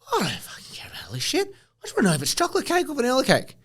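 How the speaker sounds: a fed-up whisper, rushing at the end; exasperated whisper. Retold or imitated. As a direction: hiss it out like a bored customer. Fed-up customer wanting plain answer.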